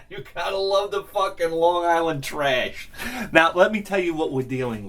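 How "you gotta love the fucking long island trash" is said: speaking through laughter; amused laugh-talk about the neighbors. Real, not acted. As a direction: still laughing as you say it. Laughing remark.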